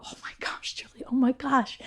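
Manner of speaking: hushed, smiling amazement; retold whisper of happy wonder; whispered joy. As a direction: whisper it through a smile. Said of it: "you see me smiling now".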